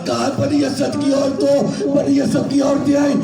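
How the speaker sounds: sustained, impassioned mourning oratory; majlis lament. Acted. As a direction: tell the tragedy in a full-voiced plaintive cadence. (پرجوش اور پردرد مصائب خوانی)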